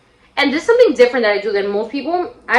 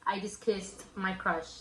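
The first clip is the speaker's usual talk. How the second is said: slow, much softer, lower voice; hushed inner thought; 3 semitones lower, 11 dB quieter, much slower than usual. Retold or imitated. Girl thinking to herself after a first kiss, hushed. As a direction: let it sink in slowly, almost to yourself. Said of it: "In my head, I legit was like".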